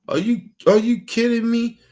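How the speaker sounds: real indignation. Real, not acted. Venting at people mad at him.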